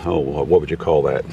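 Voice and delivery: ordinary delivery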